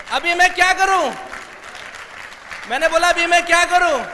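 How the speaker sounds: high, loud mock despair; desperate exclamation. Speaker re-enacts being left without a sponsor, desperate.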